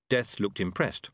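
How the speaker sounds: usual speaking voice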